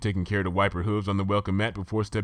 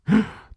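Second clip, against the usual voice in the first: gasp; 14 semitones higher, 6 dB louder than usual. Acted. Rarity gasps at guess.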